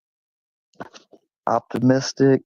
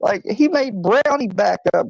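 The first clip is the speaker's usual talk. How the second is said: climbing higher and higher as the story peaks; telling it faster and more worked up; excited. Real, not acted. Telling a friend about the cat, rising excitement.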